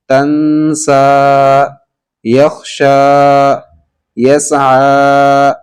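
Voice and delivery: slow, drawn-out teacher's recitation of each word; fluent model reading. Shown as a demonstration. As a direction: stretch every word out slowly for the class. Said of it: "اب اس کو روا پڑھتے ہیں". (ہر لفظ کو آہستہ اور کھینچ کر پڑھیں)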